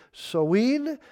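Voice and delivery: "Sowin" said so-EEN; dialect pronunciation. Shown as a demonstration. Preacher listing Gaelic dialect variants, "Sowin" said so-EEN. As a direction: say "Sowin" with a long ee ending. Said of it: "Other pronunciations that follow".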